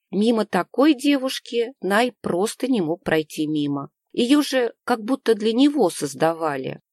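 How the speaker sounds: usual speaking voice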